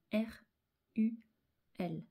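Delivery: ordinary delivery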